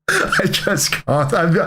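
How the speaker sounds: normal talking voice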